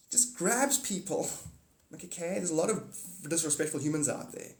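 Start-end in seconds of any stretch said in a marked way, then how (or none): none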